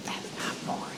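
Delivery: hushed, voiceless whisper; barely audible funeral whisper; discreet whisper. Retold or imitated. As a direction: whisper it secretly into a friend's ear. Secretly whispering to Barry at funeral.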